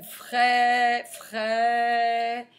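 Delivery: "fraie" slow, with a long held vowel; sounded out. Shown as a demonstration. Teacher dictating to pupils, "fraie" drawn out. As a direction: stretch both takes of "fraie".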